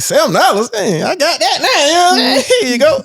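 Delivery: high, laughing bargain delight; thrilled at a cheap price, laughing; excited shopper. Retold or imitated. Buying a seven-dollar gerbil, high and laughing.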